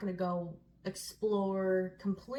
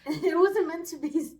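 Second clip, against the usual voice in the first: laughing; 10 semitones higher, 11 dB louder than usual. Real, not acted. Laughing through words.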